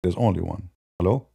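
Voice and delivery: normal talking voice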